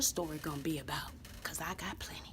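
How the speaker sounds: secretive unvoiced aside; conspiratorial whisper. Aside to class.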